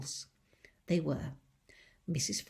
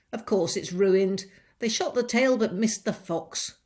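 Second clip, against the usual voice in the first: louder, brusque answer; impatient lady; 3 semitones higher, 10 dB louder than usual. Acted. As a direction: snap back that it's ruined. Mrs Bean answering.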